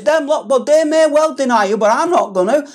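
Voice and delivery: a hint of swaggering Peter; cocky Peter. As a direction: scoff at the others. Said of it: "And Peter says".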